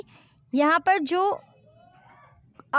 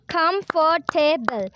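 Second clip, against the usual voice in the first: comfortable in four slow, separate beats; syllable by syllable; much slower than usual. Shown as a demonstration. Teacher does a counting activity, comfortable split into syllables. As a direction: sound out comfortable piece by piece. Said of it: "We can, uh, do an activity".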